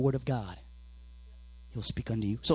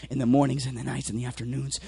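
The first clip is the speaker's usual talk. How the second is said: ordinary talk, nothing marked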